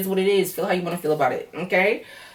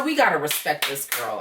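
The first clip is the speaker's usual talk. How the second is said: ordinary talk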